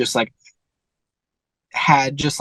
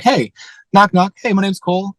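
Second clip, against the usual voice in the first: a friendly salesman's higher, bright knock-knock opener; cheery door pitch; 7 semitones higher, 4 dB louder than usual. Acted. Cole knocking on a neighbor's door, upbeat salesman voice. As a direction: chirp the greeting like a confident door knocker.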